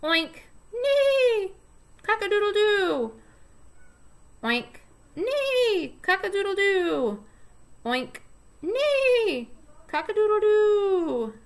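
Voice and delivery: high, loud neighs and rooster crows; playful farm sound pattern; animal sounds for a toddler. Shown as a demonstration. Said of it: "using the sounds of the animal".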